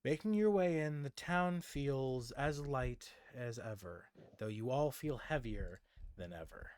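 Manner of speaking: scene narration. Acted. Game master bringing the party back to town, measured narration.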